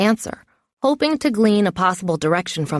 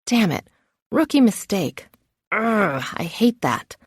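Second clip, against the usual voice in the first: ordinary delivery, nothing marked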